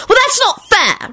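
put-on very high, drawn-out girlish protest; acted high-pitched yell; indignant yell. A narrator's reading. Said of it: "Rosie yelled".

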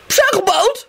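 high-pitched, testy call; irritable. A narrator's reading. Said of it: "he said irritably".

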